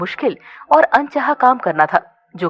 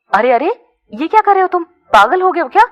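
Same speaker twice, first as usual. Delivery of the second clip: alarmed; 8 semitones higher, 5 dB louder than usual. A narrator's reading. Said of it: "घबराकर tie की नॉट खोलते हुए बोली".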